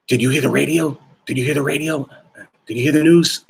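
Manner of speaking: hurried, alarmed repeated questions, voice climbing; panicked colleague. Retold or imitated.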